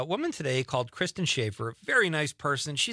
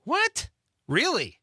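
shocked high What; surprised; 12 semitones higher, slower than usual. Real, not acted. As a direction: squeak it out in disbelief. Surprised at bingo prize.

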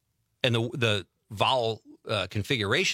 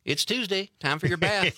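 mock Southern accent; 8 semitones higher than usual. Host joins mock Southern bit.

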